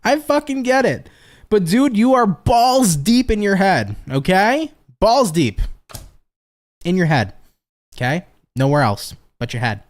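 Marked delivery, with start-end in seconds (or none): none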